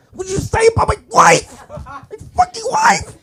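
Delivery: very high, laughing yell, slowing on wife; mock shrill husband. Comic's second take of the husband, hammed-up high screeching yell. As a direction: screech it like an outraged square.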